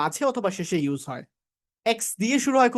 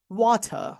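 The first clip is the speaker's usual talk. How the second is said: British "water", wah-tuh with aspirated t; British accent; 3 semitones higher than usual. Shown as a demonstration. Teacher offers another version, British accent. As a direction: drop the final r for British "water". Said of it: "ব্রিটিশরা কি বলে".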